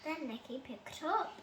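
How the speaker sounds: ordinary delivery, nothing marked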